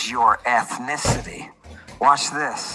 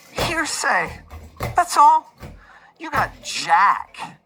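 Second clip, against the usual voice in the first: mock lawyer rant; 6 semitones higher than usual.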